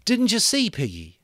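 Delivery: high, loud, feverish cry; feverish loathing. Acted. Ralph confronting Piggy with feverish loathing. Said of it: "loathing, feverish excitement in his voice".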